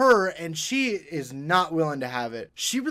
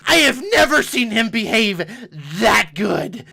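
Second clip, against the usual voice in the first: high, screeching furious yell; furious shout; 9 semitones higher, 10 dB louder, slower than usual. Retold or imitated. Mom screaming at the rude man, furious shout. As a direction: scream it like a pterodactyl. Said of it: "she just went full pterodactyl scream".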